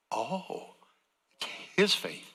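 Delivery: ordinary talk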